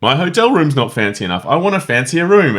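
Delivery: imitating a fussy hotel guest's demand. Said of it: "where he's like".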